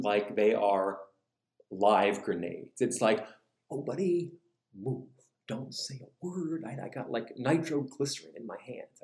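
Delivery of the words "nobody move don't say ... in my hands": very soft, careful warning; tense hush. Acted. Parent guarding sleeping kids, tense and hushed. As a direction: speak as if holding a live grenade.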